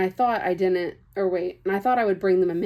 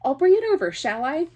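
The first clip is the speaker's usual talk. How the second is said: much higher, louder mock-helpful offer; loud sing-song sarcasm; sarcastic politeness; 8 semitones higher, 5 dB louder than usual. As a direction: lay the fake courtesy on thick. Sarcastic customer service.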